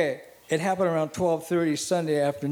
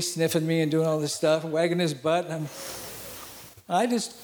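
ordinary talk

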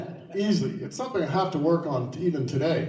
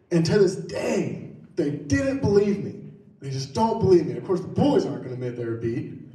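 ordinary delivery

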